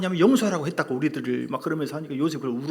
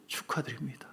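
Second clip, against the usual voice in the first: whisper; 11 dB quieter than usual. Real, not acted. Whispered aside to congregation.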